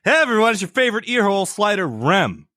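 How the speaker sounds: radio host greeting. Acted. Parody intro greeting.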